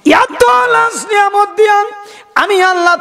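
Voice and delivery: high, held preaching cry; impassioned cry. Acted. Preacher gives Allah's words to mankind, impassioned cry. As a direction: lift it high and ring it out.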